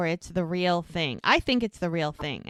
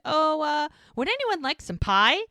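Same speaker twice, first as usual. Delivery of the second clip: high, louder, flustered offer; imitating someone nervously changing the subject; awkward subject change; 9 semitones higher, 5 dB louder than usual. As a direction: pipe up to dodge an awkward moment.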